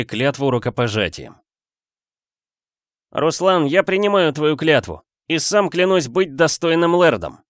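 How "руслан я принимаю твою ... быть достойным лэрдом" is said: high, projected ceremonial acceptance; solemn vow. A narrator's reading. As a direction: vow formally before the gathered people. Lord accepts oath before crowd. (торжественная клятва во всеуслышание)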